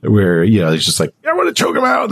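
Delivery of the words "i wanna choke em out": mock frustration. Exasperated quote about characters.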